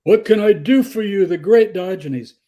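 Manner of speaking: high, loud, fawning royal voice; Alexander's courtly offer. Retold or imitated. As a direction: play Alexander grandly offering a favour. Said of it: "and he goes".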